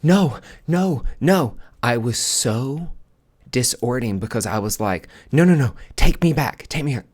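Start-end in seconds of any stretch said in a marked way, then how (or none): 0.0-1.6 high, loud, tearful no's; sobbing protest. Retold or imitated. Waking from regression, sobbing loud no's. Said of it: "and start sobbing". 5.3-7.1 loud, high, tearful begging; crying plea. Retold or imitated. Pleading with the hypnotist, crying loud.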